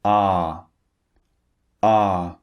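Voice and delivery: Ah held long, mouth half open; long ah demo. Shown as a demonstration. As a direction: model the long Ah. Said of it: "It's in the middle".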